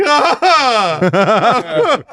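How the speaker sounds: a high, loud hammed-up sob of a wail; mock crying wail. Holding the phone as the attorney walks in, loud mock wail. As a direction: howl like you can't hold it in. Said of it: "I was just ugly crying".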